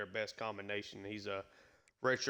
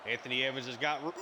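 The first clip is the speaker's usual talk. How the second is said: rising, much higher touchdown call; excited play call; 6 semitones higher than usual. Acted. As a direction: call the score like a broadcaster. Touchdown call on opening kickoff.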